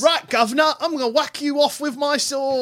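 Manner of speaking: high, hammed-up cockney British voice; over-the-top British accent; mock British accent. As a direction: play a silly cockney swordsman. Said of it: "have the worst British accent".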